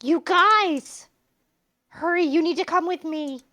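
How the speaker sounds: put-on urgent calling girl. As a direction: call them over in a panic. Clover calls urgently.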